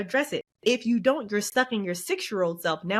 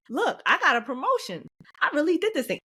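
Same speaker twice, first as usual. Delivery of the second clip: higher, celebratory self-praise; proud announcement; 7 semitones higher than usual. Acted. Telling the party about your promotion, proud. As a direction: announce your win with lifted pitch.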